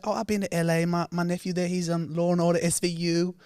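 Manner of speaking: high imitation of a chatty auntie; Auntie's voice. Retelling the auntie gushing about her nephew, pitched high. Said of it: "And she's like".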